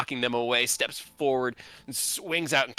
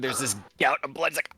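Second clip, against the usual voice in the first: usual speaking voice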